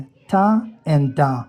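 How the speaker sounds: very slowly, "था and da" spelled out; slow sound demo. Shown as a demonstration. Teacher slowly models the sounds. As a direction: stretch "था and da" sound by sound. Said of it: "ये pronounce होता है".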